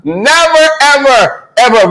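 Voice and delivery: NEVER high, then ever, ever stepping down; climbing down from a high never; emphatic never. Real, not acted. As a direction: hammer each word home. Emphatic repeated denial.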